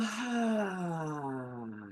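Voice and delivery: long soft breath out; slow exhale sigh. Shown as a demonstration. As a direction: sigh it all out. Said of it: "and exhale".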